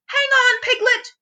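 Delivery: high, loud call from below; Pooh calling up. A narrator's reading. Pooh calling up to Piglet from below, high and loud. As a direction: shout up to a friend above. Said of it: "cried Pooh from down below".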